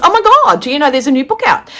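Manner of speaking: high, loud mock gush over big news; excited friend, exaggerated. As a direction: imitate an overexcited friend, hammed up and high. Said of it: "okay, she doesn't talk like that".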